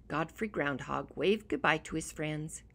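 ordinary delivery

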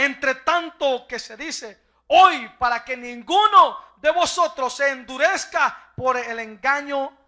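slow, loud, weighty recital; solemn scripture reading. Acted. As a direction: proclaim God's oath slowly. (lectura solemne y lenta de la Escritura)

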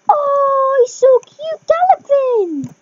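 high, slow adoring squeal; real overexcited cooing; gushing. Real, not acted. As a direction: squeal with delight, stretching the words. Gushing as the horse gallops.

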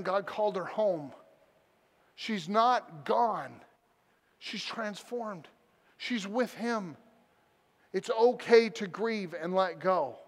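slow, measured, gentle reassurance; tender consolation. Retold or imitated. Pastor consoling a grieving son, slowly and tenderly. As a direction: comfort him phrase by phrase, unhurried.

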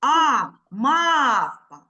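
slow мавпа with ма stretched; stressed syllable. Shown as a demonstration. Teacher showing the long stressed sound, slow. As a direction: draw out ма in мавпа. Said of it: "Де в нас протяжний цей звук?".

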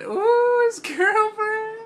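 high, loud, slow teasing squeal; teasing falsetto. Pretend. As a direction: tease him in a high sing-song. Teasing a bandmate's girlfriend.

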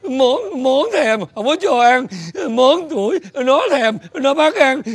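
hammed-up sobs between every few words; crying hard. Father complains to neighbour about food, crying. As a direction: blubber in broken little phrases. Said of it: "sao anh khóc dữ vậy".

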